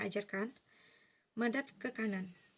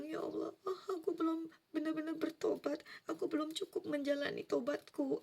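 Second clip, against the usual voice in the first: higher, tearful pleading, pitched up; crying plea; 6 semitones higher than usual. Retold or imitated. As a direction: sob out a desperate prayer. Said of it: "Sampai aku nangis-nangis".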